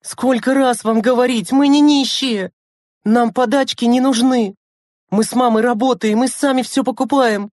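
high-pitched angry tirade; raging scolding. A narrator's reading. Lena tells her siblings off over handouts, raging. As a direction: rant indignantly at the children. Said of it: "бушевала Лена".

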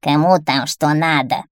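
put-on grumpy, hoarse old witch voice; irritable witch's rasp. A narrator's reading. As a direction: croak it out like a disturbed witch. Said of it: "своим хриплым голосом".

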